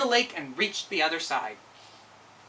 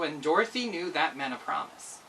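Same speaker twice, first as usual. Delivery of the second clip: ordinary delivery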